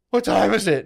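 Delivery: a lightly voiced brother; brother's question. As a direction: play the brother asking eagerly.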